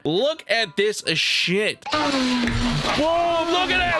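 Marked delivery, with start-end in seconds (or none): 1.9-4.0 excited shout. Real, not acted. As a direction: yell out in amazement. Amazed reaction to seeds spraying.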